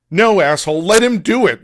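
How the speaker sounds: loud angry yell. Acted. Strom yells at Will to let staff help, angry. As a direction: order him off furiously. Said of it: "yells Strom".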